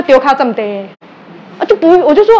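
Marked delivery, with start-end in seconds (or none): none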